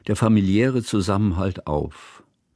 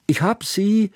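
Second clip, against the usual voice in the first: high-pitched call from another room; 12 semitones higher than usual. A narrator's reading. Hanaka calls from the kitchen, calling out. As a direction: sing out the find from afar. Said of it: "rief Hanaka noch aus der Küche".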